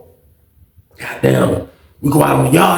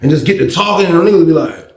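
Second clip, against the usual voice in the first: normal talking voice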